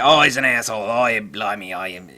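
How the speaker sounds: loud mock British griping that slides Australian; bad British accent, starting loud and fading; botched British accent. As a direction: imitate angry Brits, badly, drifting into Australian. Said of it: "that's, uh, Australian. That's not British".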